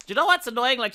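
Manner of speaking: ordinary delivery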